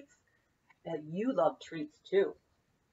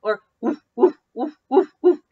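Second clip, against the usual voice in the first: loud playful woofs, louder each time; louder and louder puppy woofs; barking like a dog; 5 semitones higher, 13 dB louder than usual. Shown as a demonstration. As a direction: bark it out for the kids. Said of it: "Can you bark like a dog?".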